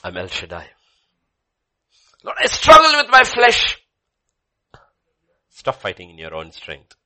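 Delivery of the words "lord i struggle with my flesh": high, very loud anguished cry; anguished believer. Acted. Believer confessing struggle with the flesh, loud anguished cry.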